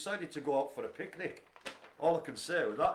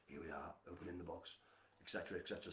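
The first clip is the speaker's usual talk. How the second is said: much lower and softer hushed nature-documentary narration; David Attenborough voice; 8 semitones lower, 14 dB quieter, faster than usual. Shown as a demonstration. Doing the party piece for a friend, hushed documentary narrator. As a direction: narrate opening a box like a wildlife film. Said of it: "David Attenborough styley voiced".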